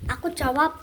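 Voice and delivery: normal talking voice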